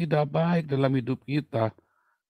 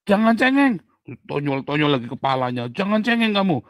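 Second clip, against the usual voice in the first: scolding father; 5 dB louder than usual. Retold or imitated. Harsh father scolds his crying child, loud and sharp. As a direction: snap at the kid to stop whining.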